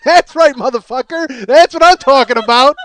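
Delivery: loud triumphant gloat. Retold or imitated. Celebrating alone in his car, loud gloating. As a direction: crow it out at them.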